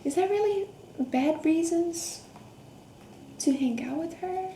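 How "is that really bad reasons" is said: ordinary delivery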